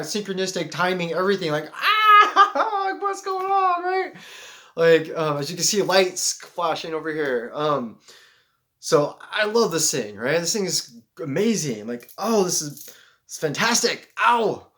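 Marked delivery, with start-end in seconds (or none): 1.7-4.1 very high, loud squeal with laughter; squeaky, overwhelmed falsetto outburst; giddy amazement. Acted. Overwhelmed by coincidences, acts amazement. 12.2-14.7 higher, punchy gushing ending in a yelp; playful exclaiming, building to a whoop; hyped delight. Acted. Exclaims over the beloved gift.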